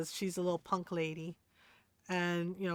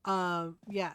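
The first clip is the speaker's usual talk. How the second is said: ordinary talk, nothing marked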